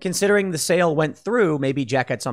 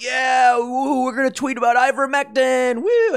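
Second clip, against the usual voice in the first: hammed-up gleeful whoop rising to a high woo; mock cheering; 9 semitones higher than usual. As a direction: hype it up like a rowdy fan. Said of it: "all these right-wing individuals being like".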